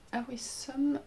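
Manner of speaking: Awesome prononcé à la française, hésitant; un premier essai d'Awesome à l'anglaise, mal assuré; accent anglais maladroit. Real, not acted. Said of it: "J'ai un accent anglais déplorable". (Awesome dit avec un accent anglais déplorable)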